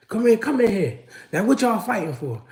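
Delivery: a hint of a firm grandma asking; grandma calling in. Grandma questions the boys.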